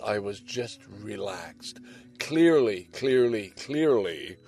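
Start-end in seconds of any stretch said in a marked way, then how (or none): 2.2-4.4 insisting "clearly" over and over, tapering off; emphatic repetition. Shown as a demonstration. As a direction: lean hard on each "clearly". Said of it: "I emphasize clearly".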